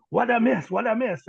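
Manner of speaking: high, loud, urgent asking; eager questioning. Retold or imitated. Pastor coming out after the commotion, excited questioning. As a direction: imitate someone bursting in with questions.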